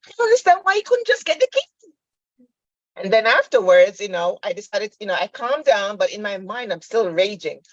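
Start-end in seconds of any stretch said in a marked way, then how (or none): 0.1-1.9 very high, exasperated protest; squeaky-high mock outrage; retold worked-up complaint. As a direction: re-enact a mother getting all worked up. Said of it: "I'm getting all worked up".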